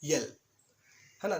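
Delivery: ordinary delivery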